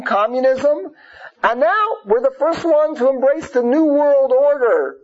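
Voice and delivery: ordinary talk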